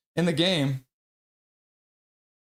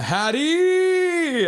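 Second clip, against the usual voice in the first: slow climb into a very high held yell; elated hat trick cheer; celebratory shout; 13 semitones higher, 5 dB louder than usual. Acted. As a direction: stretch the final vowel high and long.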